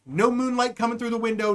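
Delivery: ordinary talk, nothing marked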